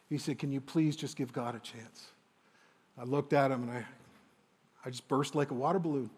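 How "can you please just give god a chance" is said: lightly voiced father, softer and tender; gentle father. Father gently listing what his son has tried, softly tender. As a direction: lay it out kindly, not accusing.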